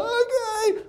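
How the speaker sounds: high, whining tearful voice; imitated sobbing child giving in; crying boy. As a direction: whimper it like a hurt kid. Crying boy obeys the coach.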